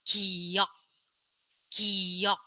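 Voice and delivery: giok repeated carefully; letter name demo. Shown as a demonstration. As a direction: say giok slowly for learners to copy. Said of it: "dia mewakili huruf K".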